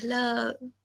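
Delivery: लब very soft and drawn out; hushed, lingering लब; slow, gentle lub. Shown as a demonstration. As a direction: stretch लब like a door easing shut. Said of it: "धीरे से दरवाजा बंद होता है".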